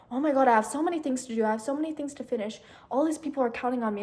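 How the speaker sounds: fast, rushed imitation of her own panic; mock panic. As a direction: rattle it off like a spiralling mind. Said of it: "I just start panicking again".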